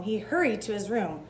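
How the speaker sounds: plain reading voice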